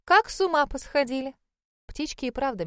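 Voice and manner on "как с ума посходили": high, loud flustered lady; fussy older lady. Acted. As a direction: fuss over the noisy birds. Fussy hostess complains.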